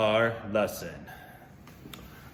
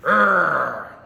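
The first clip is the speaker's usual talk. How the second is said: a playful pretend growl, louder; mock angry growl; 4 semitones higher, 10 dB louder than usual. Showing kids what lashing out sounds like, mock growl. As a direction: growl it out like a mad kid. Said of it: "just be".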